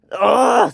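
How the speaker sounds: loud, high "ugh"; angry groan. A narrator's reading. Percy in a bad mood at Dash, angry groan. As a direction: groan out your temper. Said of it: "Percy was not in a good mood".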